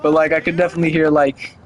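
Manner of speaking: ordinary talk, nothing marked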